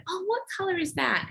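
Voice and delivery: gently lifted playful question; bright coaxing parent voice. Shown as a demonstration. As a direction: coax a toddler to look at a colour. Mother coaxing upset child.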